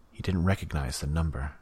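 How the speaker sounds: usual speaking voice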